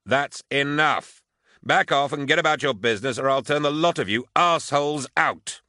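angry, high-pitched order at full voice; yelling. A narrator's reading. Officer breaking up squabbling troopers, yelling. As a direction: bellow a threat to break it up. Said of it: "Hark yelled".

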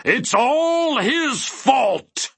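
very high, drawn-out cry of rage; furious outburst. A narrator's reading. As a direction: wail the blame in a fury. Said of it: "his sadness had changed to fury".

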